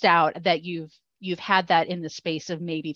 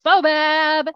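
hammed-up excited reveal, louder; excited announcement; 9 semitones higher, 7 dB louder than usual. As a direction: draw the name out with glee.

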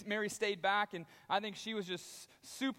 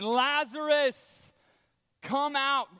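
loud, slow, higher summons; powerful commanding call; 5 semitones higher, 8 dB louder, much slower than usual. Retold or imitated. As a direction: summon the dead man out with power. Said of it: "the power of his voice of saying".

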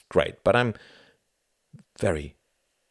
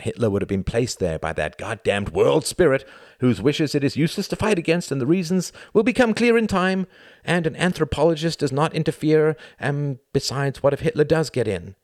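agitated rant building higher and louder; mounting agitation; 11 semitones higher, 5 dB louder than usual. A narrator's reading. Tom argues with himself late at night, mounting agitation. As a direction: work yourself up into an exasperated tirade. Tom's mounting inner argument.

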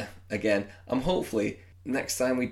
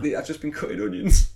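higher and louder, jokey protest; defensive joke; 10 semitones higher, 9 dB louder than usual. Real, not acted. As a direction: blurt it out as a joking excuse. Defensive joke about crying.

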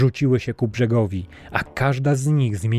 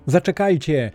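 higher, calling after them; shouting; 6 semitones higher than usual. A narrator's reading. Castaway shouting after the departing men, calling out. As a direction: shout to the men running away. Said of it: "krzycząc".

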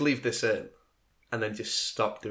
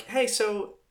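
higher-pitched casual opener; lightly voiced interviewer; 11 semitones higher, 3 dB louder than usual. Imagined interviewer to applicant, lightly acted casual opener.

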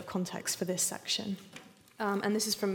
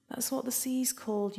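ordinary delivery, nothing marked

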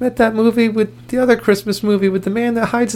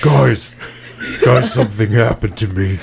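suddenly broken voice; 9 semitones lower than usual. Pretend. Boy alarmed by his own changed body, suddenly deep voice. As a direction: drop it deep as if just hit by puberty. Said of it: "Why does my voice sound like this?".

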